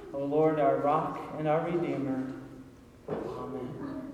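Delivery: soft, low opening prayer; hushed pulpit prayer. Acted. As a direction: pray it quietly before the sermon.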